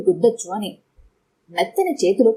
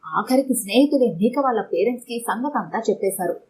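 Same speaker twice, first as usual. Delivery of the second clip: plain reading voice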